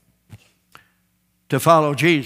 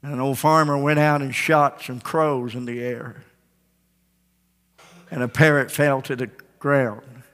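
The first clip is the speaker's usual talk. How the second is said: ordinary delivery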